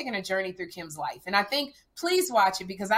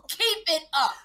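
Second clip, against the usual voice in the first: mock warning mom; 7 semitones higher than usual. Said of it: "redo it as Sherry".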